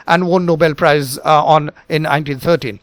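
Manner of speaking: ordinary delivery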